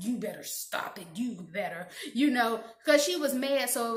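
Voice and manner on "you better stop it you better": low, softer menacing warning; hushed threat. Retold or imitated. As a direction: hiss a warning through a glare. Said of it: "crazy kind of glare".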